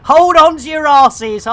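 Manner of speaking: booming hype call; rally the crowd loudly; loud announcer. Acted. Hyped announcer catchphrase.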